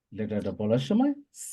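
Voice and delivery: usual speaking voice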